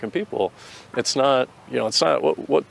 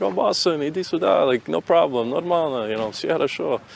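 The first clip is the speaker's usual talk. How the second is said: friendly high-pitched call from the guys, higher; lightly voiced buddies calling him over; a hint of cheerful comrades; quoting Russian comrades; 6 semitones higher than usual. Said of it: "they're like".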